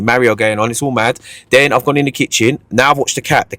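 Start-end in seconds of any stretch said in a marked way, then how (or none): none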